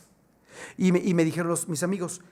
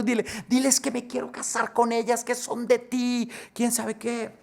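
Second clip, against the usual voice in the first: higher, tearful begging; weeping plea; 5 semitones higher than usual. Acted. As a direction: beg through tears. (ruego entre lágrimas, agudo)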